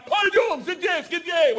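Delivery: high, loud foreign-language bark; barked order. Retold or imitated. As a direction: imitate a cop barking commands. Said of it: "I hear him bark out".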